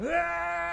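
high mock cry of a woman pushing; labor cry. Wife pushing in the car, high imitated cry. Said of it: "she's going".

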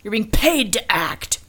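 high-pitched exasperated scolding; mock indignation. Host telling off an actor who won't go on, mock exasperation. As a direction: scold the absent actor in exaggerated disbelief.

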